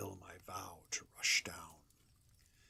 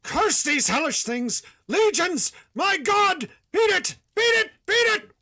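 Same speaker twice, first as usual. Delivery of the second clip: much higher, much louder shrieking; horror-struck shriek; 19 dB louder than usual. Acted. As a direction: shriek in the grip of ultimate terror. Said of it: "a shriek fraught with all the horror".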